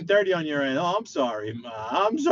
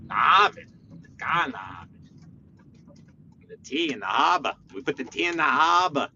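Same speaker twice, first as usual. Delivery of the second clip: mock Boston accent. New Yorker mocking people over there in Boston, exaggerated Boston accent. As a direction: parody Bostonian with stretched, R-less vowels. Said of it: "That's not how we sound".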